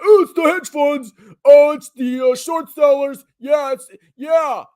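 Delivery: high, loud whiny mockery of panicked investors; mocking conspiracy theorist. Vlogger mocking conspiracy-minded investors blaming hedge funds, high loud whine. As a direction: ham up a conspiracy-theory crier. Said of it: "you're gonna hear more and more of the conspiracy theories".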